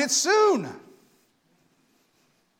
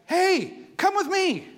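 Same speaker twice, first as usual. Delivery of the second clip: high eager call to a friend; 11 semitones higher, 4 dB louder, much slower than usual. Pretend. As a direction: wave them over cheerfully. Calling others to join.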